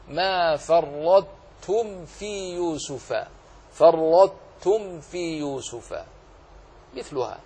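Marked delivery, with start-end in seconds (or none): 0.1-6.0 slow recitation, heavy ط in فرطتم closing into ت; partial assimilation. Shown as a demonstration. Teacher reciting another example, فرطتم with heavy ط merged into ت. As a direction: hold the emphatic ط of فرطتم, release as ت. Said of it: "تبقي صفة الإطباق مع الإدغام".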